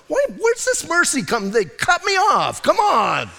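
high, hammed-up driver's complaint; mock road rage. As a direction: rant at the car that cut him off, comically.

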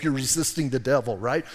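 normal talking voice

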